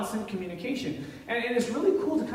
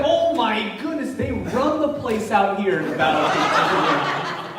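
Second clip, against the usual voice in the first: slow, louder, laughing "oh my goodness"; mock amazement through laughter; laughing disbelief; 3 semitones higher, 6 dB louder, slower than usual. The atheist reacting, laughing.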